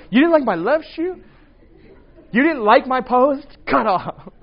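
high, loud, incredulous questions; hammed-up offended friend; mock indignation. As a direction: play petty outrage at a tiny slight. Acting out someone cutting friends off.

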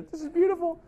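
moved listener. Retold or imitated. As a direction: play the grateful, teary friend. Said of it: "And they're".

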